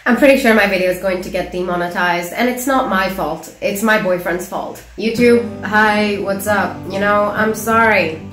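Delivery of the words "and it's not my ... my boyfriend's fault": ordinary delivery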